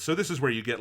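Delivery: normal talking voice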